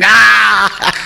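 high, drawn-out bawling scream; belted mock wail. Woman crying against the bathroom wall, belted wail. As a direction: wail as if pounding the walls. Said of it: "I belted that cry".

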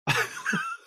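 high quick burst of laughter. Real, not acted. Host laughing at taking toys home, high quick laughter.

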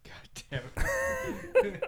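breathy whispered curse; whispering. Real, not acted. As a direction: whisper it under your breath. Reacts to dead turkey impression.

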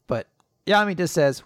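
ordinary delivery

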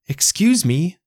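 high, small voice calling out; a little plant hailing a stranger; called out. A narrator's reading. Little plant calling to a passing creature, high and eager. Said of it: "little Baldy called".

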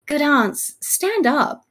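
high, light acted quote; mock kingly request. Henry telling his aunt to rise, high playacted voice. Acting Henry answering the plea.

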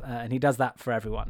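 normal talking voice

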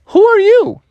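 high, loud squeak of surprise; startled question. Acted. Drowning bear meets a strange fish, high startled squeak. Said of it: "And he's like".

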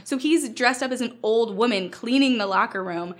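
usual speaking voice

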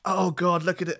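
ordinary delivery

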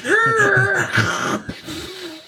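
very high, long mock screech; screeching brakes noise. Host ends the episode with a screeching-halt noise. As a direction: squeal like brakes coming to a halt.

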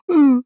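high grunting sigh; mock sigh. Retelling how Costner closes his dead son's eyes, mock mournful hum. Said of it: "he just sighs, goes".

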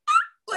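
very high excited squeal; delighted shriek. Retold or imitated. Thrilled at her friend's compliment, high squeal. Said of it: "I was like".

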